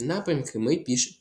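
usual speaking voice

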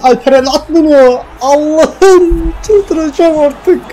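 real high-pitched, drawn-out cry of frustration; bastırılmış feryat. Real, not acted. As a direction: cry out high, holding back a full shout. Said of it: "Bağıramıyorum da. Gece geç saat". (tiz, uzayan, bastırılmış bir feryatla)

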